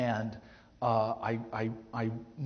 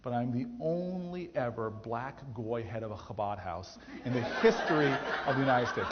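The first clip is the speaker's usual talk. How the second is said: ordinary delivery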